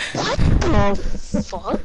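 slow, loud, stretched-out outburst; raging gamer. Real, not acted. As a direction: drag out the swear in disbelief. Missed shot during game.

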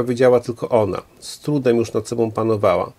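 ordinary delivery

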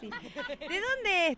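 imitating people asking. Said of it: "Te preguntan".

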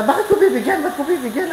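high-pitched, troubled protest; agitated questioning. Acted. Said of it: "nagugulo na siya". (balisang pagtutol, mataas ang boses)

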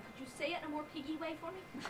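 ordinary talk, nothing marked

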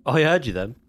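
ordinary talk

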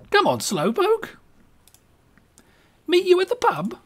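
cheery Brit calling ahead; British accent. Acted. Winston calling his friend to the pub, British accent. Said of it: "apologize to any person of English descent".

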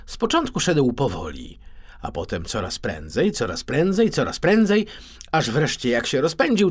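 ordinary delivery

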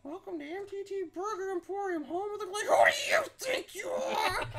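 high, loud strained lizard shout; hissing reptile growl. Acted. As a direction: screech it like an angry reptile. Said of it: "God, my throat hurts".

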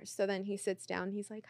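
ordinary talk

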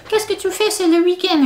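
high mock teacher voice; French teacher. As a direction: ham up the French teacher asking.

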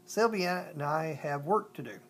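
plain reading voice

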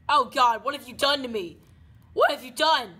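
hammed-up horror at what was done; mock outrage. After being saved, hammed-up loud dismay.

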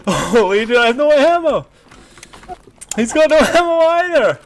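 loud, high panicked yelling; excited shouting. Real, not acted. Out of ammo in firefight.

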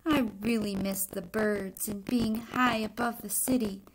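put-on expressive read-aloud to children; story reading. A narrator's reading. Teacher reading a picture book to the class, expressive narration. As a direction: tell the egg's story to the class, lightly dramatic.

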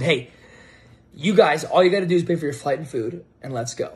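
ordinary talk, nothing marked

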